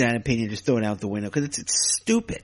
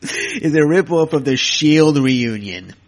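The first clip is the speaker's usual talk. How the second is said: laughing scoff; 3 semitones higher, 7 dB louder, slower than usual. Real, not acted. Scoffing repeat of claim.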